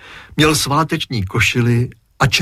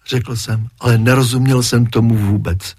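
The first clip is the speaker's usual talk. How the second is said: plain reading voice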